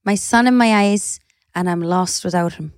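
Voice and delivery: put-on light Scottish accent, half laughing. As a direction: read it in a Scottish lilt. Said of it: "you're doing it in a Scottish accent".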